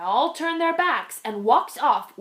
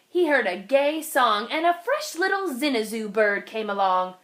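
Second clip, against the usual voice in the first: ordinary delivery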